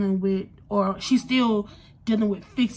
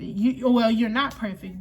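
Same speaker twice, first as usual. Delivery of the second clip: ordinary delivery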